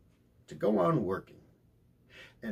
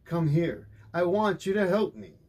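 calling; 6 semitones higher, 4 dB louder than usual. A narrator's reading. Father calling son to help.

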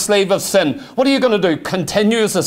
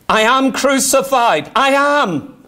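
ordinary talk, nothing marked

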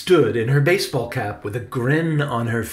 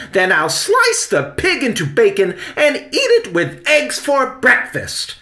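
loud, high theatrical rant; furious charge; 7 semitones higher, 6 dB louder than usual. A narrator's reading. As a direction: escalate into a shrieked mock-villain threat. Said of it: "Victoria charged".

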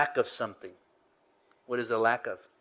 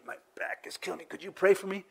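a hint of an ailing old man, higher pitch; lightly voiced old man; 6 semitones higher, 5 dB quieter, faster than usual. Old guy asks young pastor for prayer, lightly imitated. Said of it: "old guy would come to me".

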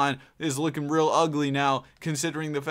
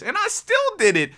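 excited brag; 4 dB louder than usual. Real, not acted. Bragging about award sweep.

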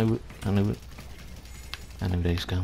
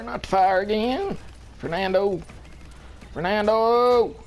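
much higher pleading whine at the teammate; panicked whine; 11 semitones higher than usual. Real, not acted. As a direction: squeal his name, begging him to move. Teammate standing in the fire.

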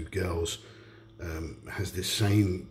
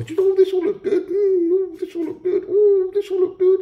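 squeaky high falsetto sneering at the writers; mock the writers in a silly, whiny, laughing squeal; sneering high mock voice with laughter; 9 dB louder than usual. Mocking the show's writers.

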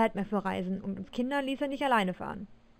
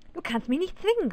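raised-pitch defiant outcry, mildly done; exclaimed protest; 6 semitones higher than usual. A narrator's reading. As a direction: call out in protest, without shouting. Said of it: "rief ich aus".

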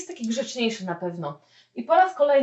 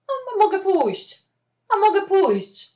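high, offhand mock shrug; offhand yes, pitched up; casual agreement; 9 semitones higher, 4 dB louder than usual. Translating casual reply.

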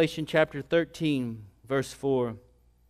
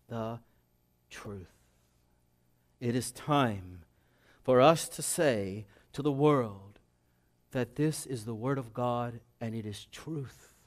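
soft, low, slow solemnity; hushed preaching; 4 semitones lower, 6 dB quieter than usual. Real, not acted. Preacher urges church to tell the world, hushed preaching. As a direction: let each phrase fall with weight and space.

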